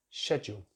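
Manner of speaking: "schedule" starting like shed; British "schedule" as SHED-yool; British pronunciation. Shown as a demonstration. Teacher recalls garden shed, "schedule" as SHED-yool. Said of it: "So always remember that".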